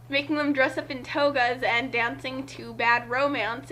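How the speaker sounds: ordinary delivery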